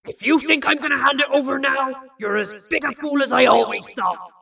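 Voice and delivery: loud, angry growl. A narrator's reading. Bill refuses Lorelei the key, angry growl. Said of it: "he'd growled".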